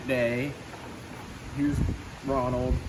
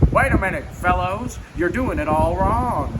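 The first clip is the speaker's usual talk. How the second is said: loud, higher shout; 4 semitones higher, 6 dB louder than usual. A narrator's reading. Donald shouting at nephews on skateboards, loud. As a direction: call out to the boys, bossy. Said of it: "Donald shouted".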